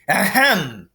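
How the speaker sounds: polite throat-clearing; ahem. Acted. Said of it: "said Dumbledore".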